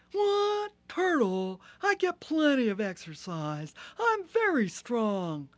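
loud, high, indignant rhino; offended Rhino. Acted. Rhino insisting he is strong, loud and indignant. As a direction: huff and protest, loud.

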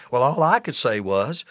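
ordinary delivery

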